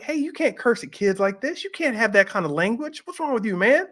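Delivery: high-pitched, indignant scolding; mildly mad. Retold or imitated. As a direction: re-enact telling off a cursing wrestler. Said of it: "I started to get mad".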